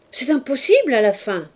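loud, high indignant cry; an outraged woman's loud protest. A narrator's reading. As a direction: burst out, scandalised. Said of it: "cria-t-elle".

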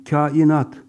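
Kainat loud with fronted a; thin long a. Shown as a demonstration. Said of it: "A incedir".

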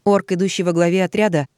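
ordinary delivery, nothing marked

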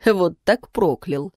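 usual speaking voice

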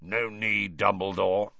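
put-on rough, wheezy old voice; wheezy growl. A narrator's reading. Moody arriving to tell Dumbledore, wheezy growl. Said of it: "said a wheezy growl".

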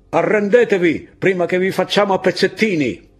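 high, loud yelled threat; shouted threat. Acted. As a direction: yell the threat up the hill. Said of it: "urlò la voce".

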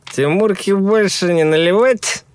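high, slow mocking quip; teasing. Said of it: "Голос Юры".

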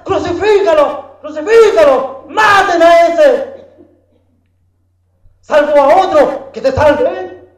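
high, loud jeering shouts; mob shouting. Retold or imitated. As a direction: yell like a crowd at an execution. Turba grita ante la cruz. (gritos agudos de turba enfurecida)

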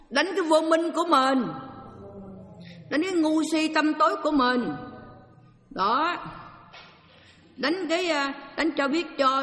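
rousing call to fight ignorance; forceful preaching. Real, not acted. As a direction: hammer home the call to fight. Preaching to the congregation.

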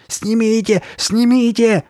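frantic high-pitched yelling; exploding. A narrator's reading. Girl demanding the face come off, frantic shouting. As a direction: shout it with all self-control gone. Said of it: "взорвалась она, потеряв самообладание".

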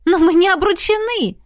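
high, loud objection; indignant protest. A narrator's reading. Heroine objects to the dragon calling them engaged, indignant. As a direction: burst out in protest.

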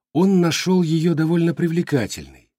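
ordinary delivery

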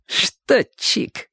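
high, taunting schoolboy voice; snide schoolyard jibe. A narrator's reading. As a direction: needle him with a sly grin. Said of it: "ехидно улыбаясь".

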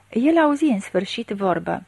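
plain reading voice

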